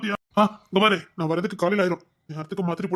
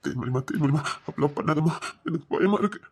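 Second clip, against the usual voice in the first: lower, coaxing plea; 6 semitones lower than usual. Acted. Husband pleading gently. (கணவன் மனைவியை மென்மையாக கெஞ்சுதல்)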